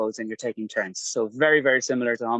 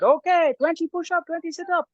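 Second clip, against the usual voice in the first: put-on Thai accent. Trainer calling out drills in a Thai accent. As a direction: bark counts like a Thai trainer, high Thai accent. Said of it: "great Thai accent".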